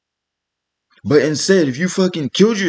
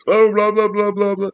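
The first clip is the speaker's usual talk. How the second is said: higher, droning blah-blah mimicry; mock manic bank rep; 5 semitones higher than usual. Mimicking the bank person refusing to listen, mock manic voice. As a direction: imitate a frantic rep in a raised, running voice. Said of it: "having a manic fucking episode".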